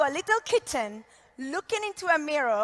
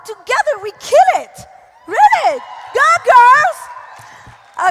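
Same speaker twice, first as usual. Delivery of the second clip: shouted, much higher hype to the crowd; excited cheer; 9 semitones higher, 10 dB louder, slower than usual. Real, not acted. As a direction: fire up the room.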